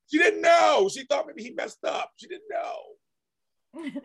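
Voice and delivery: high, loud imitation of protest; mock protesting shout. Imitating everyone making excuses for her, high mock shouting. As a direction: shout it like hammed-up excuses, stretching the last one out.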